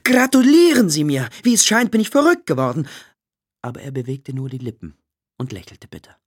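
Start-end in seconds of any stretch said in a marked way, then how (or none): 0.0-2.9 loud, high jubilant mock announcement; exuberant, self-mocking outburst; joking tone. A narrator's reading. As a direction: proclaim it like glad news, in jest. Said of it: "Er wollte in scherzendem Ton zu seinem Schwiegervater sagen".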